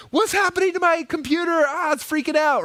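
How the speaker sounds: a flustered user losing it at the screen; mock panic. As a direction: squeal like your computer is melting down. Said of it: "You're like".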